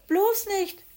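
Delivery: high, loud shout; panicked cry of no way. Acted. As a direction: yell as loud as he can. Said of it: "rief Hoppel, so laut er konnte".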